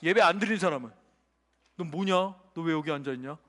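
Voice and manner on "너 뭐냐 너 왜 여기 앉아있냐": low, soft interrogation; demon's questioning. Acted. Demon asking a non-worshipper why they sit here, low challenge. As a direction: question them deep and quiet, accusingly.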